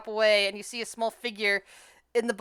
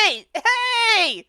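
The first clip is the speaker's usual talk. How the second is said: muffled voice; 11 semitones higher, 6 dB louder than usual. Acted. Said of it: "a muffled voice trying to call out to you from the bottle".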